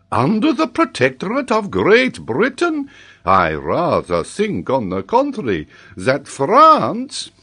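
high outraged echo, building loud on the last word; indignant disbelief. A narrator's reading. Geographer disputes the boy's answer, incredulous and affronted. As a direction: protest like an affronted French professor.

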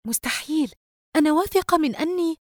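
high-pitched disbelief; astonished. A narrator's reading. Sister taking the wallet she thought forgotten, astonished. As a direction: protest in amazed disbelief. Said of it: "وقالت بتعجب".

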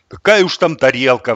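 loud, scornful retort; objecting. A narrator's reading. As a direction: cut down the idea loudly. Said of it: "возразил голос по соседству". (возражая)

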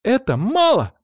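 high, loud protest; outraged high squeal; indignant. A narrator's reading. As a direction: object sharply at the top of the voice. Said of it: "возмутилась Анжела".

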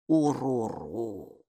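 low, slow, soft creature murmur; a shy monster says its name; creature sound. Acted. Бука, лесное существо, медленно и тихо урчит «Уруру» для Майки. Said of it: "проговорила".

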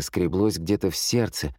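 ordinary delivery

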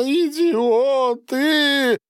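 high, long-drawn cry of despair; tearful outburst. A narrator's reading. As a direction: wail it slowly, as if bursting into sobs. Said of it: "схватился за лицо и разрыдался". (протяжный отчаянный вопль сквозь слёзы)

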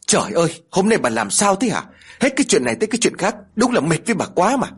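high, fast exasperated snap; snapping in anger. A narrator's reading. Old husband scolds his clumsy wife, snapping angrily. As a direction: scold her, fed up and rushing the words. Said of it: "Ông Mai liền gắt lên".

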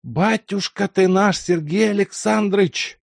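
high, loud quavering wail of joy; an old servant near tears at seeing the master's son; trembling lament. A narrator's reading. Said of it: "дрогнувшим голосом запричитал Лука".

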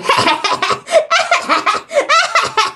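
high, taunting cackle in bursts; put-on high-pitched teasing laughter. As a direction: imitate a prankster's mocking giggle. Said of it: "cười cái kiểu ghẹo, đang chọc".

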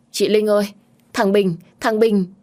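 high, loud panicked call for help; panicked cry. A narrator's reading. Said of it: "Xuân Lan hốt hoảng không nói thành câu". (hốt hoảng gọi to, giọng cao)

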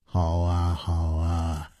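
low, soft, easy assent; amused agreement. A narrator's reading. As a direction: agree gently, on the edge of a chuckle. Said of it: "无花笑着说". (含笑低声应允)

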